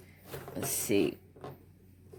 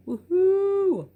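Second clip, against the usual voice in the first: high, loud drawn-out whoop; excited cheer; 6 dB louder than usual. Real, not acted. Crafter cheers over a lucky number, excited whoop.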